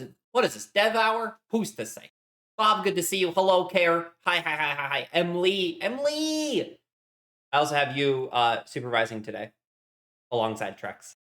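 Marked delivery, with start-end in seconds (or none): 5.8-6.8 much higher, drawn-out excited call of the name. Real, not acted. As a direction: sing the name out with delight at seeing her.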